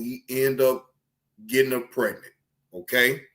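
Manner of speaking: low storytelling. Real, not acted.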